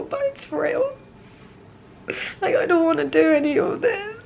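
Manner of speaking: tearful, breaking voice with long pauses; crying. Real, not acted. Patient alone in hospital crying to camera before surgery. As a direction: speak through the tears, barely holding on. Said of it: "I need to stop crying".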